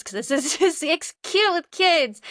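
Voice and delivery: tearful, voice rising high and loud; welling up, stretching the words out; real happy tears. Real, not acted. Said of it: "You guys can see my tears".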